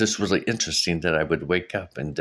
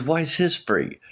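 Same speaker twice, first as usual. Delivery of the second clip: imitating an ex's challenge, pitched high; mock protest in a much higher voice; retold indignant question; 9 semitones higher than usual. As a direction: question it like a put-out ex. Ex challenges the free reading.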